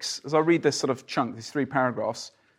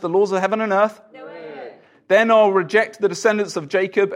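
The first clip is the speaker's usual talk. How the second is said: louder, declaiming Bible reading; scripture reading; 5 semitones higher, 5 dB louder than usual. Acted. Preacher reading Jeremiah to the congregation, louder declaiming. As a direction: proclaim the passage to the room.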